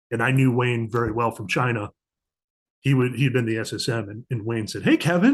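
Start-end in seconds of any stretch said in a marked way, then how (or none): 4.8-5.3 chirpy greeting. Retold or imitated. Cheery phone greetings retold.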